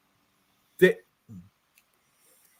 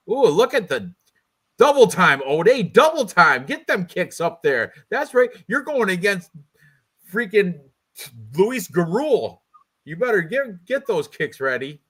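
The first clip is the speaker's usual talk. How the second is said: high-pitched hype-man cheering; playful hype; 6 semitones higher, 3 dB louder than usual. Pretend. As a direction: egg the fighter on, pitched up and lively. Cheering on the fighter's kicks.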